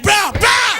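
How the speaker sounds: calling out. Retold or imitated. Hustler calling at red light.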